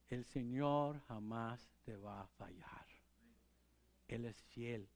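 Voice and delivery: whispered assurance; hushed, intimate promise. Real, not acted. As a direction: lean in and whisper it.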